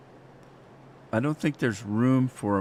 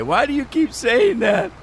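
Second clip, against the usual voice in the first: high squeaky whiny voice, much higher and louder; mocking annoyed friend; 12 semitones higher, 5 dB louder than usual. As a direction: squeak out his irritated question. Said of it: "he came after me one day".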